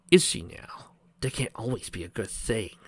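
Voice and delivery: whispered sly reply. A narrator's reading. Eggman scheming to Discord, whispered. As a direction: hush it voicelessly, scheming.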